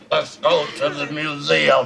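low Papa dinosaur voice; dinosaur talk. Pretend. Father playing Papa dinosaur for his child, low pretend voice. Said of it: "he talked like this".

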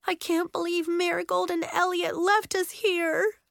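high-pitched wail of dismay; moaning. A narrator's reading. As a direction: moan it miserably. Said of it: "she moaned".

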